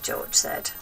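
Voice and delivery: ordinary delivery, nothing marked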